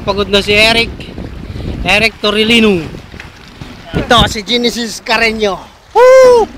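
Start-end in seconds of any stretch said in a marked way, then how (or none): 5.9-6.5 excited cheer. Real, not acted. Cheering at Red Sea trip.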